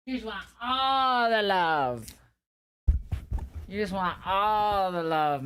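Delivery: slow high cooing to a pet. Real, not acted. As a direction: coo at your dog fondly. Baby talk to pet.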